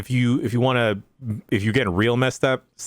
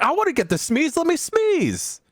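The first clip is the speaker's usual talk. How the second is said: high-pitched, excited begging; high, childlike clamour for a treat; eager demand. Retold or imitated. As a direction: whine it up high, drawing out the last word. Quoting own eager demand.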